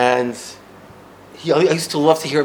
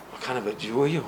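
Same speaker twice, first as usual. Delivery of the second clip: softly repeating the question to himself; hushed, wondering repeat; quiet echo; 3 semitones lower, 12 dB quieter, faster than usual. Retold or imitated. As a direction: turn it over quietly, puzzled. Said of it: "Nobody ever asked me that".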